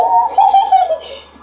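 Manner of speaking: high loud hysterical laugh; real high-pitched laughing fit; burst of laughter. Real, not acted. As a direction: crack up loudly. Laughing at flying away.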